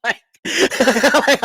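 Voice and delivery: high, laughing through the words; cracking up while explaining the joke. Real, not acted. Laughing at wife's put-down.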